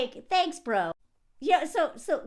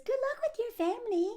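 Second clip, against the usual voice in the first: high fast chirpy farewell; 11 semitones higher, faster than usual. Retold or imitated. Parting sarcastic line.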